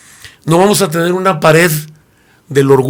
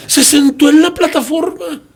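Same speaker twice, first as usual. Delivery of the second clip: much higher, scandalized cry; shocked young woman; 12 semitones higher than usual. Retold or imitated. A young woman shocked the pastor sat on the platform, high scandalized cry. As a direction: gasp it out as if he's done something sacrilegious.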